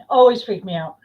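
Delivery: ordinary talk, nothing marked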